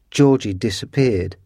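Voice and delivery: plain reading voice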